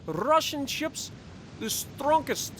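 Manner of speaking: slow, hammed-up Russian accent; mock Russian accent boasting about ships; bad Russian accent. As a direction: boast like a proud comrade. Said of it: "my horrible attempt at a Russian accent".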